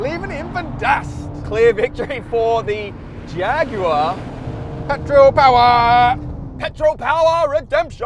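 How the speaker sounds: high, loud victory whoop; triumphant cheering. Real, not acted. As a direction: celebrate the win. Celebrating the win.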